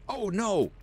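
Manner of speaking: high, startled yelp; sudden high-pitched exclamation; startled cry. Real, not acted. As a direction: cry out in real alarm, pitch jumping up. Startled by what comes up in game.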